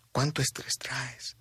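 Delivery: breathy whisper, slow and intimate; hushed slow whisper that makes the listener stop. Shown as a demonstration. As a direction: lean in and whisper the question slowly. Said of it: "repeat of the question in a whisper".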